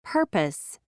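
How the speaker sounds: "Purpose" with a puff of air on the first p; "Purpose" with an aspirated first p. Shown as a demonstration. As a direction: pop the opening P of "Purpose" with extra breath. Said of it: "with an extra puff of air".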